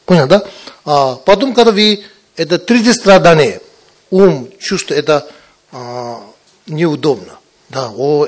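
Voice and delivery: ordinary talk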